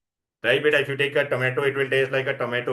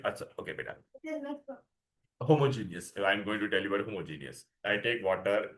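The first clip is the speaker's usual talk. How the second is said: ordinary delivery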